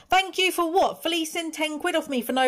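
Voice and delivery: mock the thanks you'd like to say; sarcastic inner thanks. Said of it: "but I said".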